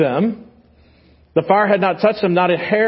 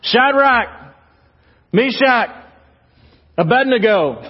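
shouted summons, each name ringing out; king shouts names; 4 semitones higher than usual. Retold or imitated. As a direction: call them out of the furnace like a king. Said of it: "and he shouts".

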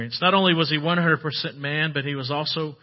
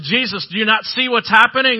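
higher, louder urgent plea; alarmed disciples; 6 semitones higher, 8 dB louder than usual. Retold or imitated. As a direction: wake him up in a panic. Frightened disciples wake Jesus in storm.

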